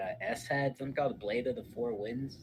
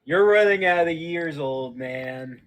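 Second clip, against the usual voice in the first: teasing taunt; 4 semitones higher, 12 dB louder, slower than usual. Real, not acted. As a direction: needle him, louder and grinning. Teasing the old rival.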